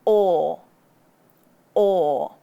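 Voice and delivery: loud rounded "or" with chin pulled back; rounded demo. Shown as a demonstration. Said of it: "make a round shape with your lips".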